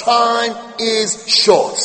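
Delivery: Time is short, each word drawn out slowly; slow right down and weigh every word; slow emphatic. Shown as a demonstration. Preacher warns congregation time is short, slow and heavy.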